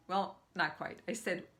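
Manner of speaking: ordinary delivery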